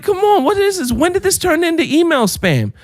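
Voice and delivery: ordinary talk, nothing marked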